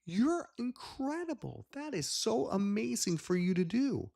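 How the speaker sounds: high, loud, slow gushing amazement; excited praise. Retold or imitated. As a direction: gush over it like a thrilled kid. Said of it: "I said".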